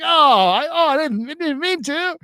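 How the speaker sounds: high, flustered backpedal; sheepish apology. Retold or imitated. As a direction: plead innocence meekly.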